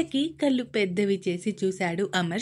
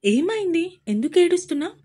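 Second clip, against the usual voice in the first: higher and louder, alarmed; worried wife asking husband why he cries; a sudden rush of worry at his tears; anxious question; 9 semitones higher, 6 dB louder, faster than usual. A narrator's reading. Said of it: "కంగారుగా సూర్యాని ప్రశ్నిస్తూ".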